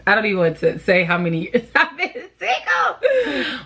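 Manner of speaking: embarrassed laugh mid-line; laughing through it. Real, not acted. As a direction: laugh at yourself while admitting it. Laughing about being single.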